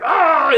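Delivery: high, loud "ah!" yell; short acted-out holler; imitated yell. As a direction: let out a sudden high mock yell. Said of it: "Like, yelling at our house".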